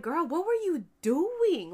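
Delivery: put-on high, loud, slow "girl, what were you doing"; drawn-out disbelief at her; exasperated. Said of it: "And I'm like".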